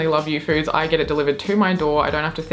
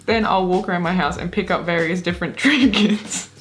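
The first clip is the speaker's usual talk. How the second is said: reading, then bursting into laughter at the end; giggling. Real, not acted. Reading out the trinket hunt, giggling. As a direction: crack up on the last word. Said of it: "if we keep giggling".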